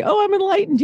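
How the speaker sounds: high, smug self-satisfied voice; mock someone who thinks they're enlightened. Mocking smug enlightened person.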